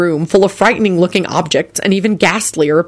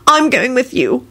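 put-on higher-pitched, determined girl's voice; 6 semitones higher than usual. A narrator's reading. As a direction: insist on it, pitched up. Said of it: "Elizabeth pronounced determinedly".

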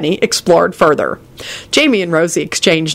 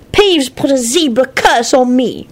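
put-on slow, higher-pitched sulky child; sullen; 7 semitones higher, slower than usual. A narrator's reading. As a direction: answer slowly, in a child's sulk. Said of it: "Teddy replied sullenly".

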